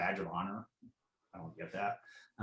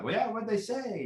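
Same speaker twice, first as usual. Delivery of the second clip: higher, put-on voice of a nosy coworker; mock eager coworker; 12 semitones higher than usual. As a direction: play the gossip hungry for the dirt. Coworkers voiced in retold gossip.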